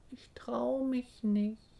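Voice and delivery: high, slow, small voice; shy little voice from inside; timid chick. A narrator's reading. Chick in the egg to mother, timidly. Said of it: "flüstert es aus dem Ei zurück".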